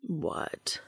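a rough whispered question; Castiel's low sandpaper whisper; deep rough whisper. A narrator's reading. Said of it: "Castiel's voice was deep and rough like sandpaper".

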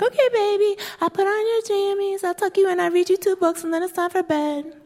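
put-on sing-song little girl putting her doll to bed. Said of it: "So she's like".

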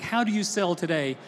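ordinary talk, nothing marked

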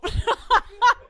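loud, high-pitched laugh bursting out; a squealing laugh of surprise; burst of high laughter. Real, not acted. Host surprised by her team's card, laughing loudly.

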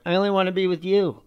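a hint of a clingy partner; mimicking girlfriends. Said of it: "are like".

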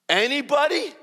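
very high, loud rising question; retold disbelief. Newcomer questions friend's claim, loudly incredulous. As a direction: squeak it out incredulously.

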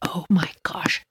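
ordinary delivery, nothing marked

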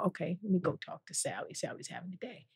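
hushed, near whispered aside; quietly sizing up the room; dropped to a hush; soft aside. Retold or imitated. Voicing herself checking on Sally.

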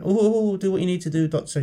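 high, loud mock moaning, easing down; pleading patient. Joke's suffering patient begs her doctor, high mock plea. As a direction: wail it like a suffering patient in a joke.

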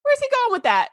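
high, baffled questions; incredulous disbelief. Retold or imitated. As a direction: react as if the plot just broke. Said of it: "I was like".

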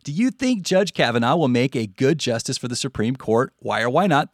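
ordinary talk, nothing marked

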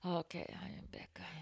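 whisper. Real, not acted. Host sneaks back into the conversation in a whisper. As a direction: breathe the words, hushed.

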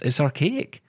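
normal talking voice

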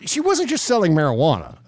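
usual speaking voice

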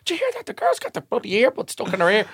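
very high-pitched mock murmur; gossiping passengers. Passengers to each other about the girl, mock gossiping. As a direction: pass it on like scandalised gossip.

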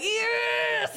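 determined yell. Retold or imitated. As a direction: shout it like you just made the squeeze. Emily squeezing truck through gap.

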